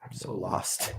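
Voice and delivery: unvoiced whispered aside; whispering. Real, not acted. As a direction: breathe it as a whisper. Aside while screen loads.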